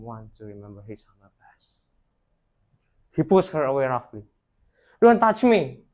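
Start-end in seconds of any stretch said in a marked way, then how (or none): 5.0-5.9 loud, high angry shout. A narrator's reading. Rich son shoving away his mother, angry shout. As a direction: push her off with a shout. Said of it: "she shouted angrily".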